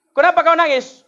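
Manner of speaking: high, loud demanding question; a mother snapping at her child; angry mother. Retold or imitated. Mother asking crying child. (ibu membentak anaknya)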